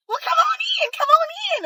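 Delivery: very high, startled mock welcome; surprised welcome. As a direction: sound caught off guard but polite. Mom in towel, surprised welcome.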